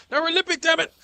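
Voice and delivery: very high, loud frantic squeal. Real, not acted. Player injured, squealing very high and loud. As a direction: squeal out the complaint in panic.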